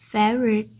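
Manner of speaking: Favourite measured out in parts, "fav-re-t", falling; divided into parts. Shown as a demonstration. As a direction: say favourite carefully part by part. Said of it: "three parts में divide करके बोलते हैं". (Favourite को हिस्सों में बाँटकर: फेव-रे-ट)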